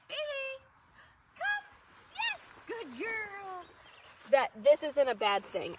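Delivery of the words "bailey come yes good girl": high, happy call to the dog; upbeat recall demo. Shown as a demonstration. Trainer showing the recall, high and happy. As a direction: call her name up high and praise. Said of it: "I want Bailey to know".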